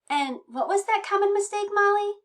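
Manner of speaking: high-pitched coaxing question; talking to Molly. Real, not acted. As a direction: ask it high, as if to a pet. Talking to special guest Molly.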